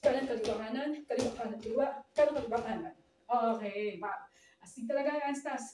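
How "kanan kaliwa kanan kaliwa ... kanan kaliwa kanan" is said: rapid-fire dance step count; speedy drill count; fast count. Shown as a demonstration. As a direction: rattle off the steps in quick groups of three. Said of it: "Mas mabilis".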